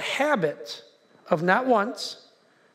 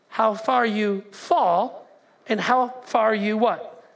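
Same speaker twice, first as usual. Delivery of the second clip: ordinary talk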